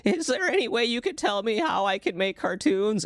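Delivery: high-pitched little kid imitation; nine-year-old kid. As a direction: mimic a polite child asking a grown-up. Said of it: "I was, like, nine".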